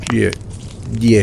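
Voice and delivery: ordinary delivery, nothing marked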